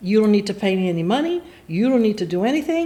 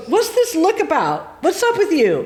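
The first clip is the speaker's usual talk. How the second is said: suspicious sister; 6 semitones higher than usual. Retold or imitated. Sister grilling her in the living room, suspicious. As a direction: imitate a sister prying, pitched up.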